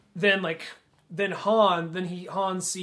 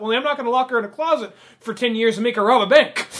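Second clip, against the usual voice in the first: laughing through the line; 5 semitones higher, 8 dB louder, faster than usual. Real, not acted. Laughing while quoting a funny line.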